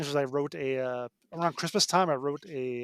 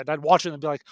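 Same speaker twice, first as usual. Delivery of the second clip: ordinary talk, nothing marked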